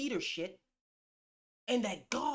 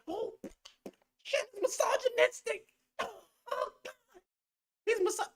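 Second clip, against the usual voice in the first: much higher, squealing mock outrage; mocking critics; 13 semitones higher than usual. Critics calling him misogynistic, high mocking whine. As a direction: mimic the shocked critics. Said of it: "if I speak like that".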